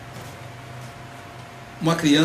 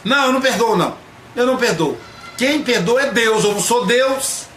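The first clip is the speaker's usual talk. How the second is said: higher, louder stubborn protest; indignant refusal; 6 semitones higher, 4 dB louder than usual. Retold or imitated. Relative insisting only God forgives, huffy louder refusal. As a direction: dig in and refuse, raising your voice. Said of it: "Fulano, perdoa".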